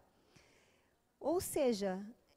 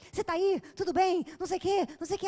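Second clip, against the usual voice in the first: put-on anxious boyfriend; 6 semitones higher, 6 dB louder than usual.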